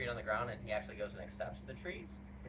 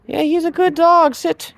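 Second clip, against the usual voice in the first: dog praise voice; 25 dB louder, much slower than usual. Real, not acted. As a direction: praise the pup.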